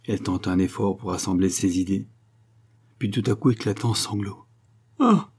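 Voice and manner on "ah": sudden high cry of grief; stricken, high exclamation; anguished "ah". A narrator's reading. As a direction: cry out "ah", pitched well up. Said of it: "s'écria-t-elle".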